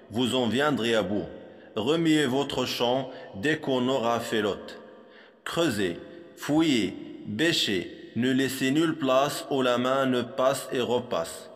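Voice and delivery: ordinary talk, nothing marked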